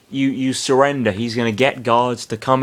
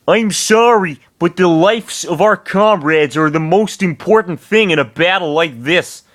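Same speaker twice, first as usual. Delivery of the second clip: higher, sorry but firm; apologetic hero; 6 semitones higher than usual. Acted. Eliwood apologizes to Lynn, higher firm voice. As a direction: explain gently why they give up.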